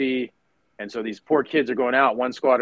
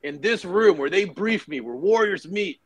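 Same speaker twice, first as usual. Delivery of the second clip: stern colonel; 7 semitones higher, 3 dB louder than usual. Retold or imitated. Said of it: "And he's like".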